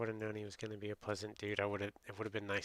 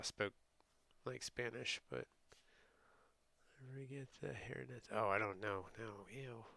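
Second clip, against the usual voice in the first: ordinary talk, nothing marked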